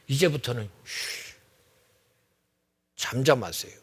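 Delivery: quiet hush to the congregation. Acted. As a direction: hush them gently into silence. Hushing the congregation.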